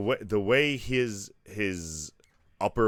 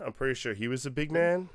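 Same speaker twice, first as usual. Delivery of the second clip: high-pitched sarcastic hedging; 8 semitones higher than usual. Real, not acted. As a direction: feign doubt with a sarcastic lift in pitch. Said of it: "I'm being sarcastic".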